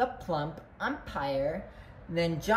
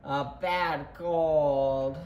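low, slow, drawn-out punchline; deflated storybook reveal; downbeat reveal; 6 semitones lower, much slower than usual. A narrator's reading. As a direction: let it sink slowly.

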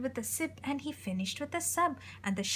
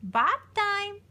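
higher, louder call of bath time; shouted; 8 semitones higher, 7 dB louder than usual. A narrator's reading. Mommy calling baby to the bath, shouting. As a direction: call it out across the house. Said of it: "shouted Mommy".

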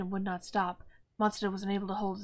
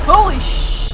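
startled shout; 11 semitones higher, 20 dB louder, slower than usual. Real, not acted. Jump scare in horror game.

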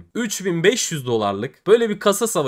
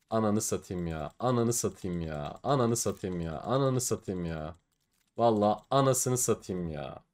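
softer, defeated swearing under the breath; despairing mutter; 4 semitones lower, 8 dB quieter than usual. Real, not acted. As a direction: mumble the curse again and again, crushed. Losing the case battle, despairing. (umutsuzca, kısık sesle mırıldanma)

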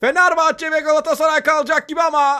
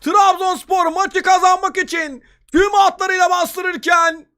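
high, raised voice, still thrilled; excited football commentator; 4 semitones higher than usual. Real, not acted. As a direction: relive the goal with excitement. Replay of the winning goal. (heyecanlı maç spikeri)

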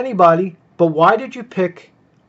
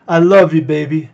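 loud quote; 7 dB louder than usual. Retold or imitated. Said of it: "that was such a slap".

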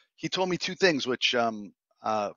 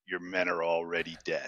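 Matrix quote; 6 semitones lower, 8 dB quieter than usual. Retold or imitated. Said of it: "the very first line from The Matrix".

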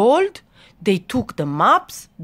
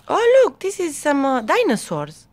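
delighted discovery, pitched way up; excited pointing-out; 8 semitones higher than usual. Acted. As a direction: exclaim to a small child at a discovery. Excited aside to listening child.

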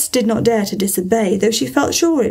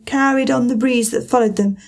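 plain reading voice

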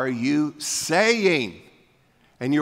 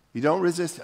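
usual speaking voice

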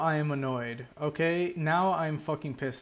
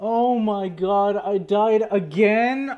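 loud, slow, higher disbelief; exasperated shout; 6 semitones higher, 10 dB louder, slower than usual. Real, not acted. Player dies yet again in the game, slow loud exasperated shout. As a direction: yell it out in disbelief.

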